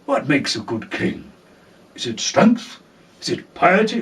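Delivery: a hint of stern old lord; Tywin's speech. Tywin lecturing Tommen on kingship, low grave tone. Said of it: "Tywin gave Tommen the big sort of speech".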